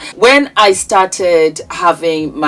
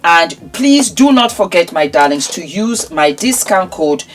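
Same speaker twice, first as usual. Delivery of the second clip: ordinary delivery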